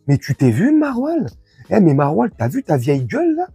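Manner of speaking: normal talking voice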